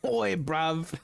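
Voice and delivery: light mock British chav accent. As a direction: toss out a chav greeting. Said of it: "I don't think I have any Jav voices".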